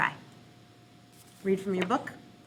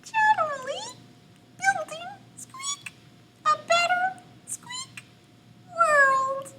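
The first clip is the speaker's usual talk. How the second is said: very high, tiny squeaky reading; mouse voice. Shown as a demonstration. Teacher demoing a mouse reading, very high and squeaky. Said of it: "like a mouse".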